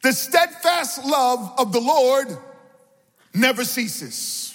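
high, rousing sermon delivery; impassioned preacher's call; preaching. Real, not acted. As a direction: exhort the congregation in rhythmic, falling phrases. Preaches with raised voice.

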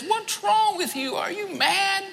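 a stranger in a nuclear war shouting at a man singing; panicked stranger. Retold or imitated. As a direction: play a frantic man grabbing someone in the chaos. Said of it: "this man grabs me out of nowhere and he says".

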